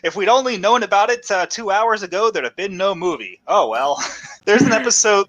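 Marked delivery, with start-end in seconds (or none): 0.0-4.0 hammed-up, higher-pitched cartoon-film voice; movie-ending reveal. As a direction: explain the twist like a corny movie finale. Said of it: "It's like the ending of Who Framed Roger Rabbit".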